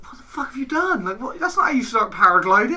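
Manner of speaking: can't believe what you've done; incredulous friend. Retold or imitated. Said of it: "he's like".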